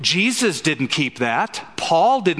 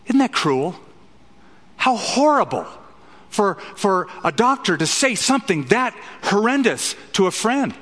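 mock outrage; 6 semitones higher than usual.

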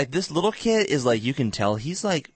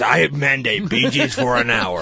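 mock decree; 5 dB louder, slower than usual. Playfully decrees Bee Gees hour.

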